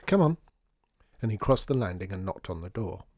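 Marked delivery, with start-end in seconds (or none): 0.1-0.4 higher, louder chivvying; urging. A narrator's reading. Father urging daughter to the door, brisk and raised. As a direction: hurry a reluctant child along.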